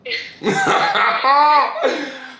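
high-pitched, drawn-out burst of laughter; real laugh. Real, not acted. Laughing after phone call with friend.

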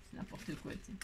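a quick whispered aside to yourself. Real, not acted. Crafter mocks her own muddle in a whisper. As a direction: whisper it under your breath.

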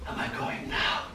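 breathless whisper, barely voiced. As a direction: whisper it as if slipping away. Dying man whispers to Death.

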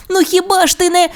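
put-on very high, quick childlike voice; coaxing little dinosaur. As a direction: start wheedling in a rush, high-pitched. Timmy starts coaxing again.

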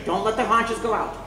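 usual speaking voice

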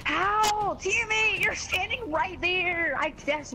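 high, loud angry yell; raging gamer. Real, not acted. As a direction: yell at the useless teammate. Raging at idle teammate.

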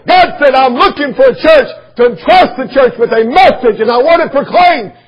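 high, loud pulpit shout; shouted preaching. Real, not acted. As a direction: thunder it out to the whole church. Preaches at full force.

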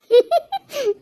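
high giggle; short giggling laugh. Acted. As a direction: giggle at being teased. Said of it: "சிரிக்க தானே செஞ்சா".

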